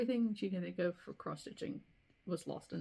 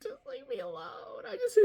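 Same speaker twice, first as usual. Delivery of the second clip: high, teary mock wail; mock sobbing plea. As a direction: replay your own crying plea. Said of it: "And I'm like".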